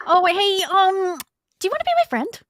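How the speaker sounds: high, eager, awkward friend-seeking voice; squeaky needy sidekick voice; timid Arnold voice. Acted. Player voices Arnold character.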